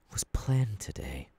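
whispered reproach to the captive; whispered aside. Acted. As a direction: trail off into a whisper. Villain scolding a bound captive.

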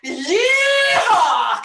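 high, drawn-out cowboy whoop; Cowboy Cal. Pretend. Host introducing the cowboy puppet, whooping. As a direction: holler a long yeehaw. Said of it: "we've got Cowboy Cal".

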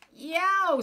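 loud yelp, pitched far up; pained yell. Acted. Giant biting the iron bread, loud pained yowl. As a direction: howl as teeth crack. Giant breaks teeth on pan.

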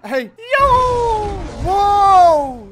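high, loud whoops of awe; excited whoop. Real, not acted. Gamer watching Hulk smash the city, loud high whoops. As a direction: cheer like something huge just happened.

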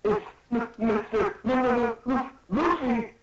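very high, stuttering awe; hammed-up fanboy squeal; starstruck stammer. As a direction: stammer the name, overcome with excitement. Starstruck character stammers name.

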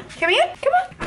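high rising pet call; sing-song call to the dog. Real, not acted. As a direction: coax the dog over, pitched high. Calling the dog.

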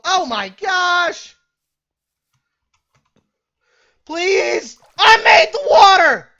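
loud high desperate yelling; frantic shouting. Real, not acted. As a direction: shout in breathless panic. Escaping, frantic.

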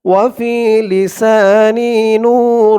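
slow, drawn-out Quranic recitation. Shown as a demonstration. Teacher intoning the opening to viewers, slow recitation. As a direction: intone each word slowly and devoutly.

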